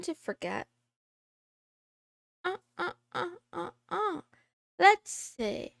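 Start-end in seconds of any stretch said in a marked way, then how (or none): none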